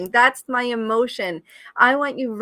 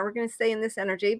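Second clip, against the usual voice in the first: ordinary talk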